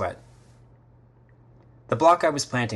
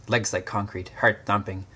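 usual speaking voice

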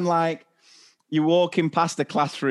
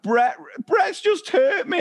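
put-on much higher, slow tearful kid voice; whiny child; 14 semitones higher than usual. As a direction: whine it like a hurt little boy. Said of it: "he was like".

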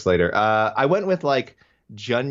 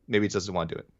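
ordinary talk

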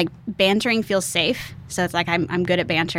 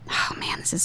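whispering; 7 dB quieter than usual. Real, not acted. Savoring something.

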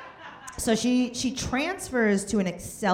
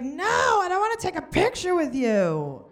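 higher mock sulky refusal; mocking husband; 10 semitones higher than usual. Husband refusing a selfie with girlfriend, mock sulky. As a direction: put on a whiny husband voice.

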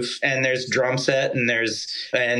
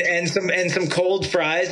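normal talking voice

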